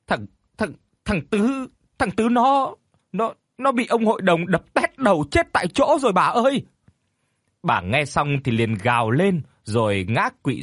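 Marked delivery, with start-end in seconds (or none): 0.1-6.6 high, panicked halting report; breathless stammering. A narrator's reading. Neighbour gasps terrible news to the mother, stammering. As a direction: stammer out bad news, pitch climbing. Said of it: "thở hổn hển rồi lắp bắp trả lời".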